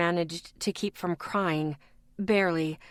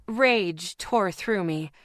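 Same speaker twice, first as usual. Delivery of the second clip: usual speaking voice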